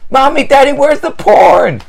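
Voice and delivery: put-on high, slow, loud child yell; little girl calling out for the popcorn in the store; child screaming. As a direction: shout across the store like a lost kid. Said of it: "screaming at the top of her lungs".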